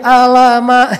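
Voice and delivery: "alama" drawn out as an Indonesian reciter would; Indonesian-accented letters. Retold or imitated. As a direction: imitate a local mispronunciation in "alama". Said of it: "jadi A jadi nga gitu kan".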